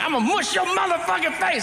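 high, put-on convict threat; high-pitched mock menace; threatening prisoner. Prisoner threatens to mush a kid's face, high mock threat.

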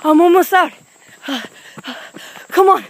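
high, loud, desperate push; frantic urgency. Real, not acted. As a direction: urge yourself on in a panic. Escaping at the exit.

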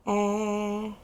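Е held long and level as a pure vowel; long, steady Е, shown to pupils; isolated vowel sound. Shown as a demonstration. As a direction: sound out Е as one sustained vowel. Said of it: "голосний".

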